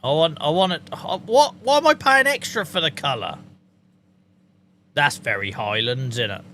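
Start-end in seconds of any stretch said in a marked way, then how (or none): 1.2-3.4 indignant surprise. Real, not acted. Streamer outraged at paying extra for colour, high indignant. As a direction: sound scandalised at the price.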